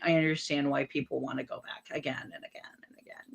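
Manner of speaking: near whisper. Real, not acted. Guest remembering Italy to the host, whispered softly. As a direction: breathe it out softly, longing to go back.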